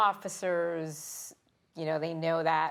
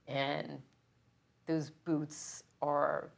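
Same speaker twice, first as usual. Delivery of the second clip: choked up. Real, not acted. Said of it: "you're getting emotional".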